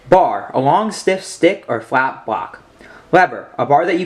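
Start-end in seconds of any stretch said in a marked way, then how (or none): none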